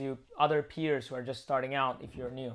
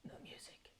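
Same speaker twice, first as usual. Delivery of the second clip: whisper; 26 dB quieter than usual. Real, not acted. Hushing while the stream plays.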